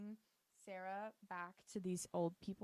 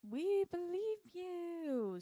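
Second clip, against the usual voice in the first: very drawn-out, high singsong insincerity; exaggerated mock reassurance; 9 semitones higher, 4 dB louder, much slower than usual. Everyone backing Patricia's accusation, hammed-up mock reassurance. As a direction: stretch every word high and fake.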